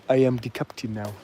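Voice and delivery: put-on Somali accent; Somali pirate impression. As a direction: imitate a Somali pirate taking over. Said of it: "We've got some Somali pirates".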